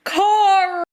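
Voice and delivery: high, drawn-out imitation of the catchphrase; imitated calling out. Imitating how everyone greeted a friend named Carl, mock scolding. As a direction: call the name out high, mockingly. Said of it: "it was always".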